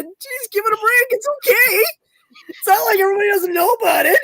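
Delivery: high-pitched mock exasperation; retold exasperation. Retold argument with her mom.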